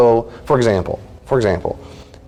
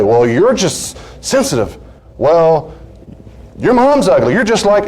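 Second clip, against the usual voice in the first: mock petty taunt, climbing higher; hammed-up childish dig; immature escalation; 8 semitones higher than usual. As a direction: escalate like a squabbling spouse. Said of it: "when they're really immature, they escalate bigger and bigger".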